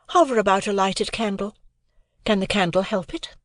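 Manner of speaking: put-on a proud young woman answering lightly; Estella voice. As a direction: play Estella, poised and aloof.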